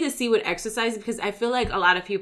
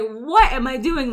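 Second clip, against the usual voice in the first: slow exasperated groan of a question; 4 semitones higher, 6 dB louder than usual. Retold or imitated. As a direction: ask it fed up and slow. Miserable newcomer.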